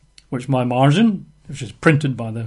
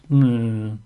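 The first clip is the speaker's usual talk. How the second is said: doubtful musing; 4 semitones lower, much slower than usual. Acted. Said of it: "he thinks".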